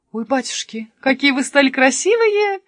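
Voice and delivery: oh my, how lovely; amazed exclamation. A narrator's reading. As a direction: exclaim in delighted wonder. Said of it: "воскликнула она".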